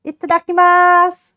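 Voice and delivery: excited Itadakimasu. Real, not acted. Saying grace before eating.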